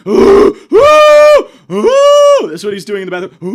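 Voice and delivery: a hammed-up gasping outburst; mock gasping shout. Comic recalling the bathroom kid, mock gasping shout. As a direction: gasp then shout it, half-mocking.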